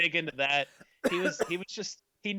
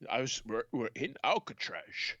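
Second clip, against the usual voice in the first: Sean Connery. Acted. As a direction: draw it out with slushy Scottish s sounds. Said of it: "when he was on The Rock".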